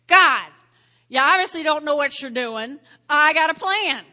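loud, mocking complaint at God; mock prayer to God. Preacher acting out a self-willed prayer, loud and mocking. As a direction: scold God like you know better. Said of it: "I will do this".

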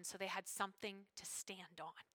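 breathy unvoiced whisper. Real, not acted. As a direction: lean in and whisper it. Sermon drops to a whisper.

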